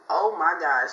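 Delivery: ordinary talk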